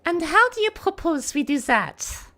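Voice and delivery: Celeste. Acted. Voice actor retaking a challenge line, high French-accented character voice. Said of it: "doing, like, a higher voice".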